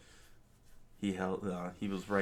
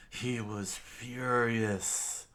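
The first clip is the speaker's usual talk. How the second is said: ordinary delivery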